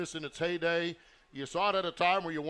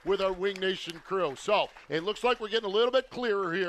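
loud, higher hype announcer voice; announcer sign-off; 6 semitones higher, 6 dB louder than usual. Acted. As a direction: pump up the crowd on the mic. Host signs off the broadcast.

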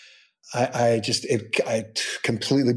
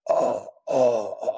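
half-sobbing, imitating a moved father; choked-up dad; slower than usual. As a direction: let the old man's voice catch on each oh. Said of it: "And he goes".

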